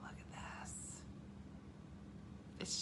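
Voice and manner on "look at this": slow, hushed whisper of wonder; breath-only marvelling; awed whisper. Retold or imitated. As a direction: whisper it slowly, as if amazed. Imitates hushed amazement at film.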